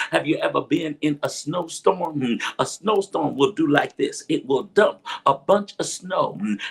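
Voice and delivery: ordinary talk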